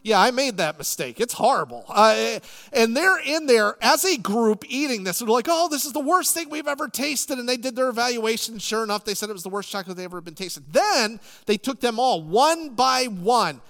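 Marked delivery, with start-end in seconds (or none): 5.5-7.4 higher, exaggerated disgusted groan; mock disgust. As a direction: ham up the disgust. Group tasting bitter chocolate.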